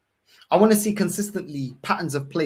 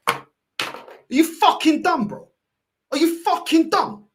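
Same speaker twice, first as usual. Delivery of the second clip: high, loud incredulous yell; angry disbelief; 11 semitones higher, 4 dB louder than usual. Real, not acted. Fan berating the manager over his answer, outraged. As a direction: hurl the question at him, pitch way up.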